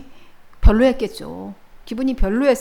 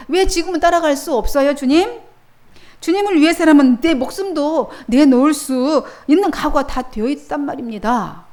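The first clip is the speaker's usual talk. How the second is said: higher, louder protest of a hurt disciple; 베드로의 항변; 4 semitones higher, 6 dB louder than usual. Acted. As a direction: plead your loyalty, raised and insistent. 베드로의 항변을 연기함. (높고 크게 항변하는 베드로)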